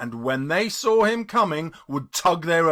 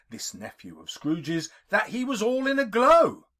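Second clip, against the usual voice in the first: ordinary delivery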